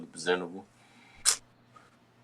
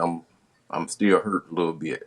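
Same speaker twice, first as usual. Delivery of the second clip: ordinary delivery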